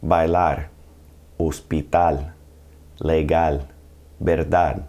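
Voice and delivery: "Bailar, hospital, legal, verdad" as bai-LAR, os-pi-TAL, le-GAL, ber-DAD; last-syllable stress. Shown as a demonstration. Teacher models second category, Bailar to verdad, final syllable stressed. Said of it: "on the very last syllable".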